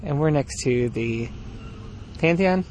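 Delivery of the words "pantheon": ordinary delivery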